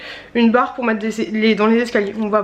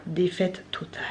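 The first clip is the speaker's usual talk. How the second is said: whisper; 4 semitones lower, 10 dB quieter than usual. Real, not acted. Disappointing package, mock despair.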